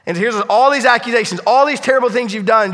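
loud, high, insistent preaching; preaching at full force. Acted. Preacher confronts congregation with accusations, loud and driving. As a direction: hammer home the list of sins.

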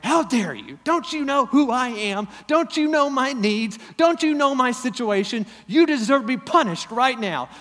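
indignant outburst. Retold or imitated. Spouse offended by partner's remark, hammed-up indignant rant.

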